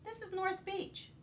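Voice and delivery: put-on high, bright kid voice; high child voice. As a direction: answer like a small boy. Boy answers pirate.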